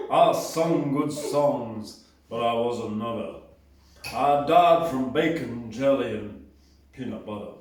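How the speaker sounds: deep Southern [name] impression, lazy and low. Poet reading his [name] poem in a low Tennessee [name] voice. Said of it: "[name] voice and deep in Tennessee-ish".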